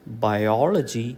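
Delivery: biology said slowly as bi-OL-ogy; stress on second syllable. Shown as a demonstration. Teacher contrasting two words, slow bi-OL-ogy. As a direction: put the weight on OL in biology. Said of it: "syllable which is given importance".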